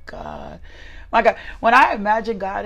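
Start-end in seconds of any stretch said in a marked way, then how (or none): 0.1-0.6 barely voiced hush to open the prayer; breathy whisper. Real, not acted. A preacher alone before the stream whispers, hushed whisper. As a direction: whisper it to yourself like a private prayer.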